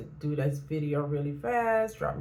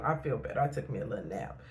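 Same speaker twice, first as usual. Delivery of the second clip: ordinary talk, nothing marked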